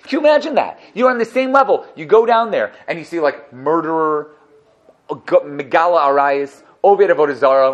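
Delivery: ordinary talk, nothing marked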